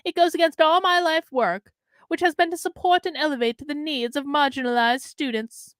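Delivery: quoted statement read with a Southern lilt; Southern accent. Acted. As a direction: let a Southern voice take over the quote. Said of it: "I don't know why I have a Southern accent".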